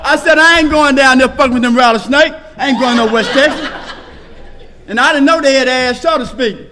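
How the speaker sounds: high, loud, driving pulpit delivery; shouting testimony from the podium to the room; full-force preaching. Acted. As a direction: preach it to the back row.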